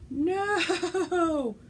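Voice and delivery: gasp, then a high loud no; horrified at the ruined floss; shocked gasp and cry of no; dismayed gasp. Real, not acted. She just pulled her floss.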